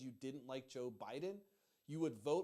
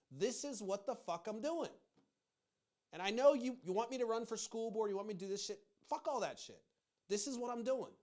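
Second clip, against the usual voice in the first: fed up, emphatic and higher-pitched; real heated rant; 6 semitones higher, 4 dB louder than usual. Real, not acted. Vlogger firing back at people telling him to run for office, exasperated rant. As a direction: push back hard, voice raised in exasperation. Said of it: "I'm a yeller and a screamer".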